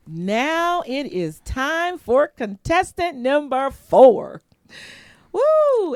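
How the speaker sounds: loud game-show host build-up ending in a whoop; high, loud "Woo!" cheer; announcer hype. Pretend. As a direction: bring on the contestant like a TV host. Game-show host introducing contestant.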